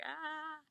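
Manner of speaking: high mock yelp of panic; nervous squeak. Hearing her name called, a high nervous yelp. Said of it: "I was just like".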